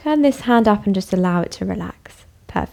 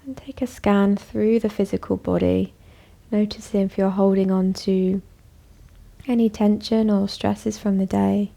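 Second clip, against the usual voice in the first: calm, even voice with long pauses; guided meditation; 3 dB quieter than usual. Acted. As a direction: guide them gently inward, leaving space after each phrase. Leads a bedtime meditation.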